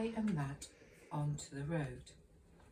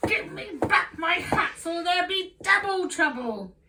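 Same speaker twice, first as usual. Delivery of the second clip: much higher and louder, stamping fury; furious yell; 12 semitones higher, 10 dB louder than usual. Acted. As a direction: scream the final warning. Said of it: "The man was furious".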